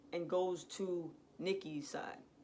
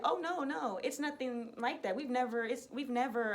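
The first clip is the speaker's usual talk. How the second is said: higher-pitched imitation of a protesting woman; flustered denial; 4 semitones higher than usual. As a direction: hurriedly deny it, stumbling over words. Assistant flustered, denying affair.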